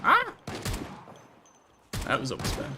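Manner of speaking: ordinary delivery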